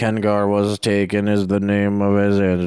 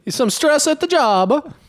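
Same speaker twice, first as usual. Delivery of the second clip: put-on Mexican accent; 3 dB louder, slower than usual. Doctor accent bit.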